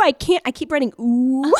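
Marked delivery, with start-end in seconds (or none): none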